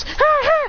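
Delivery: high "ha, ha!"; acted high-pitched cackle; mocking rival laugh.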